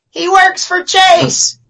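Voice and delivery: high-pitched mock call-out; imitating heckling girl. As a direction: stretch it out high, like a girl outing someone. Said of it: "this girl behind me goes".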